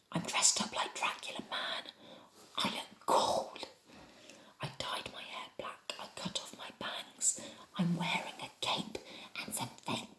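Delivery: hushed, secretive whisper. Shown as a demonstration. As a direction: whisper it like telling someone a secret. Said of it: "You could read it in a whisper".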